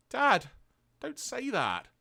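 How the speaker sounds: high, pleading son; hammed-up tearful boy begging; Daniel. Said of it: "Daniel's got tears dropping down his eyes".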